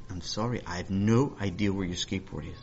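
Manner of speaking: low-key denial. Acted. Acted brother's low-key denial.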